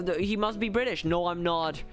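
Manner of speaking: ordinary delivery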